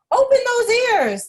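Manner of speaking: high loud mouse cry; cried out. A narrator's reading. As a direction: yell encouragement up at Dumbo. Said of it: "Timothy cried".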